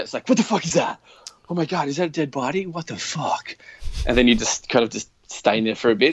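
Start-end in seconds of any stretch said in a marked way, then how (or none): 0.3-3.6 high, panicked game-character outburst; character freaking out. Retold or imitated. Gamer imitating his startled character, high and panicked. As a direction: voice the startled avatar seeing a dead body. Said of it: "your character full freaks out".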